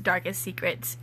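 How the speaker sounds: usual speaking voice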